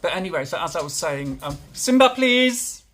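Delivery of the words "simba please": very high, exasperated shout; loud pleading yell to a pet; yelling at a dog. Real, not acted. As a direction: shout the dog's name, climbing higher.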